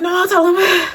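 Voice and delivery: high, loud acted outburst; retold protest. Answering friends who threaten to tell, high loud protest.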